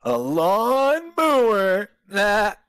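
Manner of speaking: high, loud, slow punchline; drawn-out punchline. Acted. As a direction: stretch the punchline out big. Delivers the joke's punchline.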